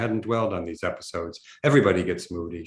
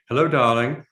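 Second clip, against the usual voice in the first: put-on mother's English accent, pitched higher and louder; 4 semitones higher, 6 dB louder than usual. A narrator's reading. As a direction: greet in a lightly voiced English accent. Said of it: "Mom said in her English accent".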